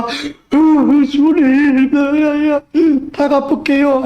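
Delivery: high, hammed-up sobbing plea; theatrically weepy, drawn-out cry; mock tearful wailing. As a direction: blubber it in exaggerated fake repentance. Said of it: "삭개오 울었다는 얘기 없습니다".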